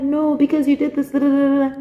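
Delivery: high, tearful complaint; put-on whiny sobbing, tailing into babble; mock crying. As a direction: imitate yourself protesting through tears. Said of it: "Ngakhala even more when I saw him".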